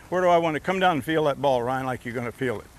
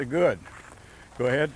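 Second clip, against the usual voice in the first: usual speaking voice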